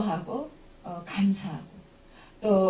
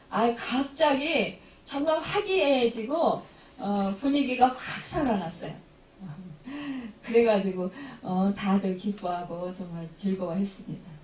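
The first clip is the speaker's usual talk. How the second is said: ordinary delivery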